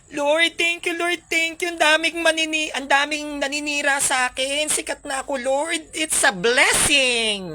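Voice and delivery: overjoyed celebrity thanking God; mock grateful prayer. (kunwaring masayang dasal)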